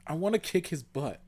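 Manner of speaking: high-pitched whiny protest; petulant high whine; whining. A narrator's reading. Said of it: "Buffy whined".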